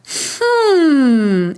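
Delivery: a hummed exhale through the nose; demo hum. Shown as a demonstration. Coach demonstrating a warm-up hum. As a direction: hum it out and sigh. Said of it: "I would just hum by exhaling through your nose".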